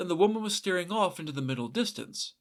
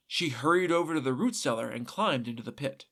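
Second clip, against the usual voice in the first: usual speaking voice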